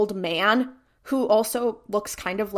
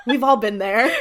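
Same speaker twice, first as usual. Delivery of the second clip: said through real laughter; words laughed out over the punchline; amused, laughing it off; 6 dB louder than usual. Real, not acted. Laughing at own joke.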